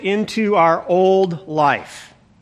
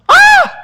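sudden high yelp of scandal, much louder; mock shocked shriek; 8 dB louder than usual. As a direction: squeal like you just saw something outrageous. Shocked at Jesus dining with sinners.

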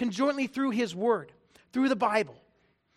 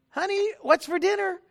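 higher, louder call from the living room; 8 semitones higher, 5 dB louder than usual. Retold or imitated. Husband calling his wife from the living room. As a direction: call out again, a touch louder. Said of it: "Walked into the living room".

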